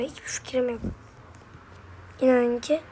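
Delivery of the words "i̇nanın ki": usual speaking voice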